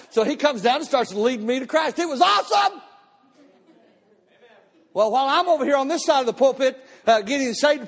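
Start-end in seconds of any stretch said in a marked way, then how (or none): 2.0-2.8 high shouted joy; excited. Real, not acted. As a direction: burst out with it. Joyful exclamation about getting saved.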